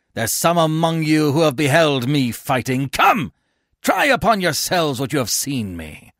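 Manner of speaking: high, loud dare; defiant challenge. A narrator's reading. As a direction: dare the attackers to fight. Coriolanus challenges attackers to fight.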